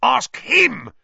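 shrill, loud accusing cry; shriek. A narrator's reading. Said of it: "shrieked Filch".

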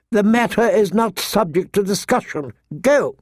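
high-pitched, final governess voice; stern septa. A narrator's reading. Septa dismisses the protesting girl, sternly. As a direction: shut down the protest as a strict septa.

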